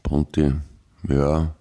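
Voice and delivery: ordinary delivery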